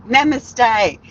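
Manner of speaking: a smug parting namaste; pointed namaste. Retold or imitated. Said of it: "And I said".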